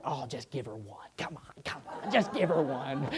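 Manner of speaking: soft, half-whispered tease, egging the officer on; teasing undertone. Retold or imitated. Pastor teasing his mother-in-law to the officer, in a soft whispered tease. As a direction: hush it like a cheeky aside. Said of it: "I was like".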